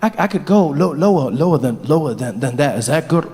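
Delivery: stepwise drop in pitch. Acted. Barack lowers his voice for Mandela, step by step. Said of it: "Lower than that".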